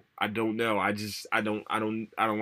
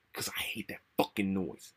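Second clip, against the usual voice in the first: whispered; 6 dB quieter than usual. Real, not acted. Irritated aside about typing noise.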